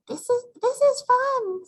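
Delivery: put-on high, excited little girl voice; little sister voice. Jezebel the little sister telling her sisters it's fun, high girlish voice. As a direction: squeal it like a tiny kitten girl.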